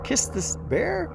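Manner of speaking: high-pitched rising disbelief; incredulous. Retold or imitated. Dreamer puzzling over God's command, high incredulous questioning. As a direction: question it in squeaky mock astonishment.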